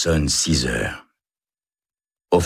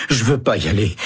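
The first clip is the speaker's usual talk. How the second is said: higher, tearful plea; pleading in tears; 6 semitones higher, faster than usual. Acted. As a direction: beg not to be taken. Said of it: "se met à pleurer". (supplication en larmes)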